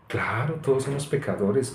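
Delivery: soft, cutesy mock-pious voice; little cartoon-doll voice. Preacher mimicking a churchgoer answering, soft doll voice. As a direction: say it softly like a little puppet. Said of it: "con una voz estilo como".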